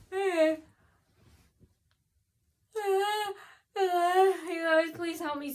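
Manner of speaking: high, loud whiny cry for help; drawn-out wail easing into pleading; wailing plea. Pretend. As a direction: beg the listeners with a put-on sob. Mock plea to viewers for help.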